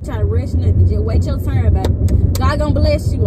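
ordinary talk

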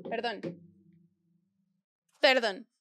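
normal talking voice

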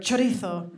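"chorizo" with a showy Spanish lisped z; exaggerated Castilian "chorizo"; Spanish pronunciation. Shown as a demonstration. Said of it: "I pronounce words in their original accents".

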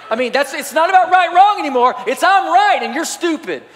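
rising to a loud, high preacher's climax; preaching at full force. Acted. Pastor to his church, driving home the point in a loud, high preaching build. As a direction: build the sermon to a near shout.

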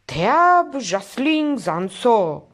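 loud, high, bellowed nonsense words; frightening bellow. A narrator's reading. Drunk painter bellows gibberish to scare the lieutenant, loud roar. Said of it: "scoase un muget înfricoșător".

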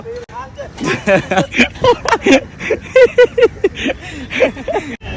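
long high-pitched giggling fit; long laugh. Real, not acted. Laughs at the opponent's ignorance.

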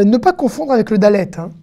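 ordinary delivery

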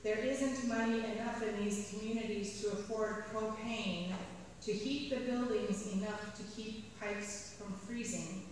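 ordinary talk, nothing marked

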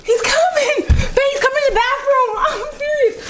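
high laughing panic; laughing scream. Real, not acted. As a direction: shriek through laughter.

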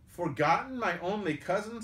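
high, amazed question; astonished recognition. A narrator's reading. Robin learns the stranger is his cousin, astonished. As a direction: exclaim it in rising wonder.

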